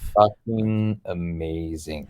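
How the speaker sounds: low, soft and very slow; deadpan. Real, not acted. Host asks about fame in Serbia, slow low deadpan answer. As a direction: drag it out, dry and understated.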